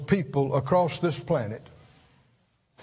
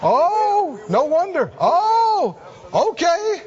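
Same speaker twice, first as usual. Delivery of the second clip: sudden realization; 8 semitones higher, slower than usual. Pretend. Saints in heaven realizing things, mock surprise. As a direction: act out the light-bulb moment.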